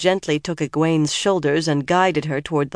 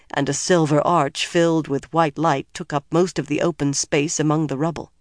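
plain reading voice

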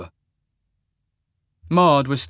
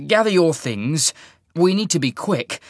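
urging; 6 semitones higher, 3 dB louder than usual. A narrator's reading. Urging lover to leave before dawn.

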